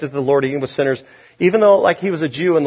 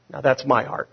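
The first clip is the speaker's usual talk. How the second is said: ordinary talk, nothing marked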